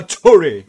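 loud, puffed-out "Tory"; mouth full of air. Shown as a demonstration. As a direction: fill your mouth with air and burst out "Tory". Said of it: "tu enche a boca de ar".

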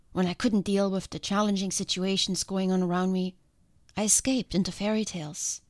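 ordinary talk

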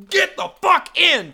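high, loud, drawn-out yell; acted-out enraged command; imitated angry order. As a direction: order your partner into the car, furious. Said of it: "And he goes".

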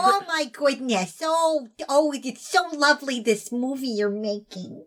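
slow, lilting grandma impression. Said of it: "See, that's a grandma voice".

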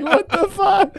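high-pitched mock French swearing; French-accented incredulity through laughter. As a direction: squeal it in a put-on French accent. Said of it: "but in a French accent".

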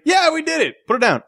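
high, hammed-up cheer of triumph; mock celebrating. Retelling heroes who beat the timer, mock celebrating. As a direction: play two guys cheering their success. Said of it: "they're celebrating".

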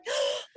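sharp high mocking gasp. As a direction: gasp like an overdramatic villain. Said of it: "she's all like".

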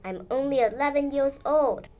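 high, rising protest; indignant kid objecting to a parent. A narrator's reading. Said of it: "I protested".

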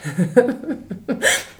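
bright burst of laughter at herself; amused giggle, rising high; delighted laugh. Real, not acted. Amused at own wild plans.

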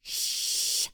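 breathy shushing hiss, no voice; unvoiced shush. A narrator's reading. The Spec Three shushing them again, hissed shush. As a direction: hush them again with a long shh. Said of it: "shushed them again".

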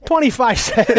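high, deadpan toll demand; mock toll collector. Toll collector demanding his fare, high and deadpan. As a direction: hold out your hand and chirp it. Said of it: "He's like".

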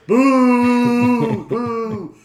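loud, high booing; booing heckler. Retold or imitated. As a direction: boo from the crowd, slowing at the end. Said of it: "he's just going".

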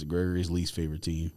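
ordinary talk, nothing marked

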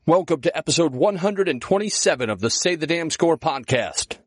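ordinary talk

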